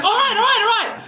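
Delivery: high squeaky imitation of a man backing down; mock panicked stalker. Mocking the stalker as he gives in, high and pleading. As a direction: whine it like he's caving.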